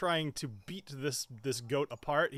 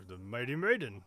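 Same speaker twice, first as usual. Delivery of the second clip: Salt the cook; 2 semitones lower than usual. Acted. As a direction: answer like a dim-witted brute. Game master answers as Salt.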